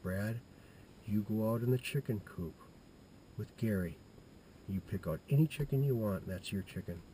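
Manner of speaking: a hint of a mother, said softly; hushed mom voice. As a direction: softly grant the boy his wish. Mom voiced giving permission.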